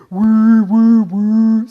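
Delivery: loud, steady whirring woos; vacuum cleaner noise. Acted. Speaker imitating a vacuum cleaner, loud pulsing whirr. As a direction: whirr like a working motor. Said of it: "here's a vacuum cleaner".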